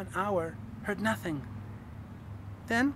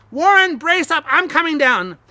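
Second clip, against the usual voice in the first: loud, higher determined shout; shouted resolution; 9 semitones higher, 15 dB louder than usual. A narrator's reading. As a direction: call down bravely. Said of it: "I formed and shouted a resolution".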